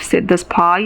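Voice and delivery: ordinary delivery, nothing marked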